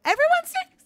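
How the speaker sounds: high, loud excited screech. Real, not acted. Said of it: "Oh my God, I'm screeching".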